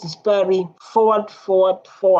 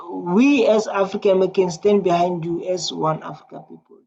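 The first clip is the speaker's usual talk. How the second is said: normal talking voice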